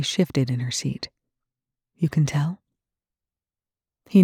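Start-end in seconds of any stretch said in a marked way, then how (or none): 2.0-2.6 hushed question; whispering. A narrator's reading.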